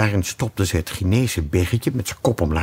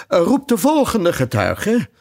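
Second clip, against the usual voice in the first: king; 11 semitones higher than usual. Acted. King calls a witness.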